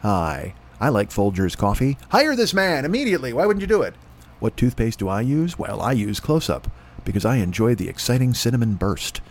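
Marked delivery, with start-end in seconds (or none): 0.0-2.0 deep, smooth voiceover guy; voiceover announcer. Pretend. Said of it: "That's my audition right there". 4.4-9.2 mock ad read. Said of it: "See, look at this".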